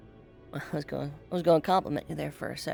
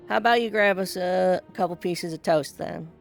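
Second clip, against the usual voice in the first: raised voice calling upward; shouting up scaffolding; 3 semitones higher, 7 dB louder than usual. Acted. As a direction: call the offer up to him. Calling up to Pip above.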